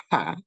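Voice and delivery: "pa" with a puff of air after the p; aspirated p. Shown as a demonstration. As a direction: burst the p of "pa" open with breath. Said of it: "aspirated sound".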